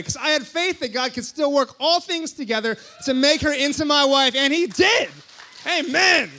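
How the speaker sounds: rising to a loud, high shout of praise; preaching climax. Real, not acted. To the congregation, a loud, high triumphant shout. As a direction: build it up and shout the victory.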